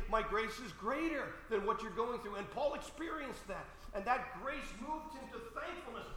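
high, intense voice held back in volume; fervent preaching. Real, not acted. Preacher telling how grace moved Paul to thankfulness, fervent high voice.

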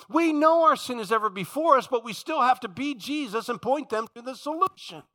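high, loud, insistent preacher; full-force preaching. Real, not acted. Preacher arguing his right to call out wrong, full-force preaching.

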